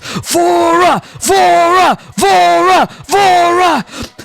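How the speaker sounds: high arena chant, shouted; yelling crowd. Acted. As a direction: chant it like a crowd cheering its gladiator. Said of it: "The sounds of people yelling".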